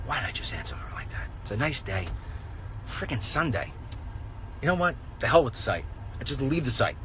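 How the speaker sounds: quiet self-talk; inner thought. Retold or imitated. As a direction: think it to yourself, quietly. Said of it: "I'm thinking to myself".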